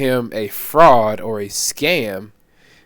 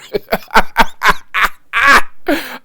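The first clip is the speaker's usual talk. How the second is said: loud, high laughing fit; high burst of laughter; 10 semitones higher, 6 dB louder than usual. Real, not acted. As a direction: crack up loudly. Laughing at the viral story.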